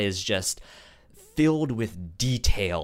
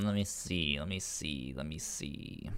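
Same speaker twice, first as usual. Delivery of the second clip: fading mutter; 7 semitones lower, 12 dB quieter than usual. Real, not acted. Pondering aloud, fading.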